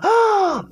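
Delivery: high-pitched inward gasp. Shown as a demonstration. Teacher showing the tone before lowering it, high gasp. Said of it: "lower the tone".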